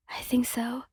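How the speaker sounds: soft, understated shock; a touch of shock, said quietly; trembling with shock. A narrator's reading. Shaken crash survivor answers their partner, mildly shocked and soft. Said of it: "your voice trembling with shock".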